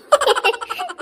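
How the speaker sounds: high, loud burst of laughter. Acted. Wife laughs at husband. (ಜೋರಾದ ನಗು)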